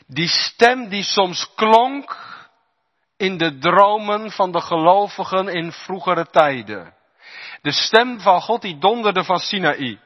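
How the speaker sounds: high, loud, slow thundering preacher; fiery pulpit preaching; preaching at full force. Acted. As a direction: proclaim God's thundering voice.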